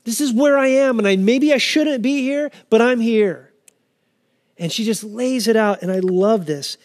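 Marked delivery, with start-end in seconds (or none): 0.0-3.5 higher-pitched, emphatic plea to God; honest laying-out. Acted. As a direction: lay it all out frankly, voice raised in pitch. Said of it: "Be honest with him and just lay out".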